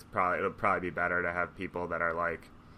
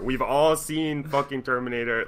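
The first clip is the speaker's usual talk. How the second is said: emphatic repeat; 5 semitones higher, 4 dB louder than usual. Real, not acted. Warning his friend about AI, higher and louder insistence.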